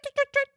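high tret tret call. Shown as a demonstration. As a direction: chirp it like a lemur. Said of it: "a whoop doesn't sound like a tret".

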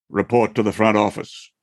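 lightly voiced stern school announcement; a hint of summoning gravity over the PA; serious announcement. School secretary summoning a student to the office, a lightly serious tone. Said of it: "in your most serious voice".

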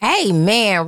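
normal talking voice

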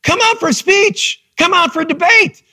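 high, insistent invitation; coach urging. Retold or imitated. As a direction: urge him warmly to join. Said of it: "And he said".

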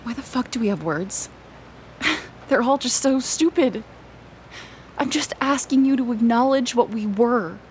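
normal talking voice

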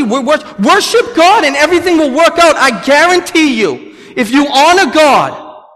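loud, high, impassioned pulpit exhortation; forceful preaching. Real, not acted. Preacher guaranteeing congregation it will work out, forceful preaching. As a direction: drive it home, slowing on the promise.

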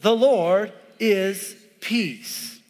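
slow, drawn-out reading; read aloud together. Shown as a demonstration. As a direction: read it slowly with the congregation. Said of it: "read it with me".

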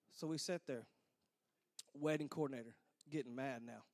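ordinary talk, nothing marked

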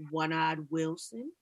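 lightly voiced, low, slow old man; a hint of a teasing old man, slow; lightly voiced old student teasing his teacher; gruff nickname voice. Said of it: "the voice that you just did".

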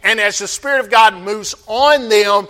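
usual speaking voice

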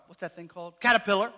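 ordinary delivery

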